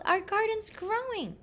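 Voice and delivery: high-pitched storybook yell; excited yell. A narrator's reading. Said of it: "I yelled".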